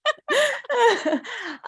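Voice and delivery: high, loud burst of laughter. Real, not acted. Laughing at friend's toddler-years dismay, a high loud laugh.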